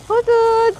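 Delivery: high mock owl hoot. Hooting at husband in the store, mock owl call.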